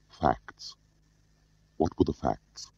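softly thinking aloud; inward musing. A narrator's reading. Smiley asking himself for the facts, softly musing. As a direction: drop right down, as if asking yourself.

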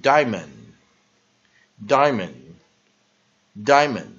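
"diamond" punched loud on DIE, weak mund; slow, loud model "diamond", pausing between repeats; first-syllable stress. Shown as a demonstration. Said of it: "所以重音在第一个音节die".

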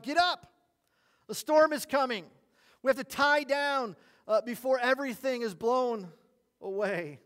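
loud, high-pitched alarmed farmer; urgent yell. Acted. Farmer waking the hired man before the storm, urgent yell. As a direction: yell it to rouse a sleeper as a storm comes. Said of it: "shook the little man and yelled".

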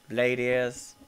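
ordinary talk, nothing marked

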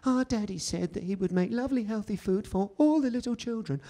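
lightly voiced sweet little girl; a hint of a sugary four-year-old; cutesy child voice. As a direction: read it like a cutesy packet child. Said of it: "it's all cutesy and crayony".

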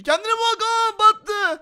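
high-pitched exclamation of dismay; alarmed yelp. Pretend. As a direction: yell as the footing sinks. Platform sinks under him. (telaşla bağırma)

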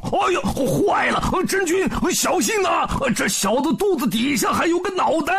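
Pigsy shouting a warning to Erlang; alarmed shout. A narrator's reading. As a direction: yell out to save Erlang. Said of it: "吓得猪八戒在旁边大叫".

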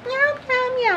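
high little mock meows; imitated kitten meows. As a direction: mimic kittens mewing for attention. Imitates kittens waking her up.

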